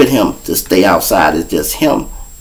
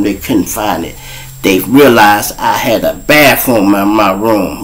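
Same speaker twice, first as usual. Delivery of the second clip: ordinary talk, nothing marked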